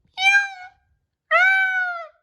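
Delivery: very high, soft kitten meow; a tiny faraway kitten meowing. Acted. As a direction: mew like a small lost kitten. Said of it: "he thought he heard a soft".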